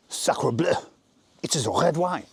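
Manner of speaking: hammed-up French accent; indignant comic Frenchman with a French accent. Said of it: "French accent".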